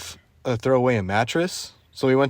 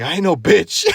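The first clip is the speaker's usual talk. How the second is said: higher, louder mock squaring-up; retold defiance; 6 semitones higher, 5 dB louder than usual. Storyteller as dad getting in the man's face, mock defiance. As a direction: stand up for yourself, half amused.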